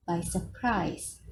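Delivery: ordinary delivery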